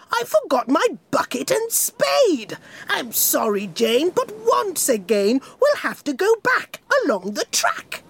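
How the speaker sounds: a lightly voiced king saying sorry to the train; apologetic king. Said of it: "King Jolly said".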